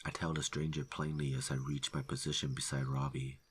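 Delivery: ordinary delivery, nothing marked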